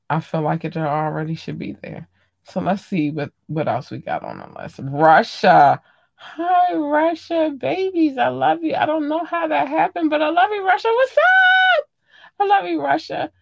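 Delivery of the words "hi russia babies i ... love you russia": very high excited gush, building to a shriek; squealed affection. Pretend. Thanking surprise listeners in Russia, high squealing affection. Gushing greeting to Russian fans.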